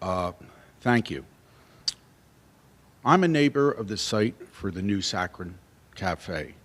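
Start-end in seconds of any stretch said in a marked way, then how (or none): 3.1-6.5 speaking much softer, hard to hear; soft-spoken, too quiet for the room. Real, not acted. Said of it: "We're just having a hard time hearing you".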